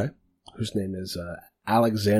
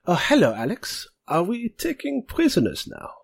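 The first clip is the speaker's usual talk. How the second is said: much higher, lightly mocking greeting; teasing voice; 11 semitones higher than usual. Second bandit teases Alex about bringing a prisoner, high and mocking. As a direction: needle an old friend about his captive. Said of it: "that other voice says".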